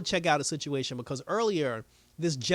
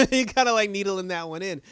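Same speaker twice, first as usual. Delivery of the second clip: amused laughing; 5 semitones higher, 7 dB louder than usual. Real, not acted. Viewer teasing Beth over her dig, laughing. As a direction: burst of high, loud laughter into the words.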